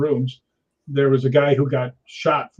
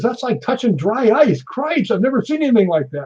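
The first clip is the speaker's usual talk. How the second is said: higher, amazed woman's voice; 8 semitones higher than usual. Retold or imitated. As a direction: gasp it out like touching dry ice. Woman touching his arm, amazed.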